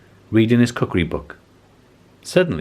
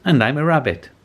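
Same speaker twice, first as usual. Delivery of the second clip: rabbit voice; 7 semitones higher, 3 dB louder than usual. Acted. Rabbit introduces itself.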